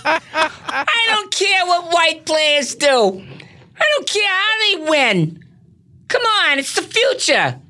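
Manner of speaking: high-pitched indignant rant; Marge Schott. Acted. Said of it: "Marge Schott missed out on the".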